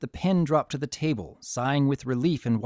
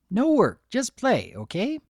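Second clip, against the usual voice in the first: bubbly Pinkie voice; 8 semitones higher, 3 dB louder than usual. A narrator's reading.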